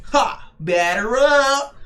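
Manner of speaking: slow, high cocky call; hammed-up batter. Voicing Luffy at the plate, slow cocky high call. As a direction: call it out like a showboating hitter. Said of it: "And he's like".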